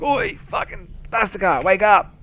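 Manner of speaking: high-pitched, rousing dad impression; mock wake-up shout, pitched high; dad's wake-up call. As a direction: imitate dad hollering someone out of bed. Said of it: "he'd be like".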